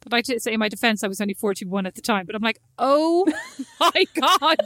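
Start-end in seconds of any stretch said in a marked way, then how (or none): 2.8-4.6 laughing groan. Real, not acted. Laughing horror at memory.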